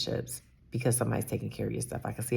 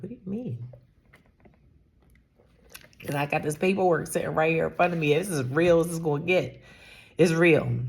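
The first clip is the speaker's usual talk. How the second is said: higher-pitched, retold disbelief; acted-out indignant comeback, pitched up; incredulous protest; 5 semitones higher, 4 dB louder than usual. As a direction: argue back, insisting on it. Acting out her protesting reply.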